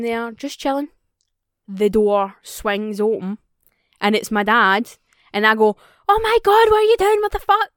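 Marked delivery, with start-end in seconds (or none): 6.1-7.7 high, loud shocked shriek; startled scream. Retold or imitated. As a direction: scream in sudden fright. Startled by dad in bath.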